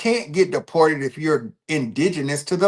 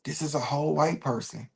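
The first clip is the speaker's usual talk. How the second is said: hushed, breathy whisper under the breath; whispered aside; 3 semitones lower, 10 dB quieter than usual. Retold or imitated. Sitting by pale coworkers claiming the tribe, whispering to himself. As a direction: whisper it so the coworkers can't hear.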